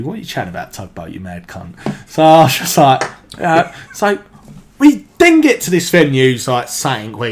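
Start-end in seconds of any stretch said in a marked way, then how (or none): 0.0-1.7 hushed, low mock telling-off; disapproving aside. Mates to Tugboat after his odd remark, hushed reproach. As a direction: scold your mate under your breath. Mates' silent look voiced as words.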